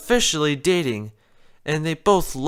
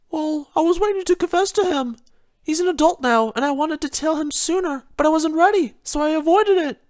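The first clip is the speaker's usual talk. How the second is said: much higher, earnest Rarity voice; truthful Rarity; 12 semitones higher than usual. Acted. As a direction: confess it sincerely, up high. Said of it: "Rarity said truthfully".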